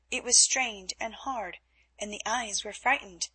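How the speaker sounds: ordinary delivery, nothing marked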